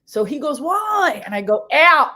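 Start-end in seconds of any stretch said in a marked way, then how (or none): 0.6-1.2 high-pitched mock whine; imitated high, plaintive protest; brother's wail. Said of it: "he's crying". 1.7-2.1 one loud, higher shouted order; imitated South Philly sister yell; really loud bossy sister. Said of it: "really loud like that".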